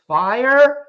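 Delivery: imitating a tentative, questioning scream; timid mock scream. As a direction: raise it loud and high, but unsure. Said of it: "How would you scream fire?".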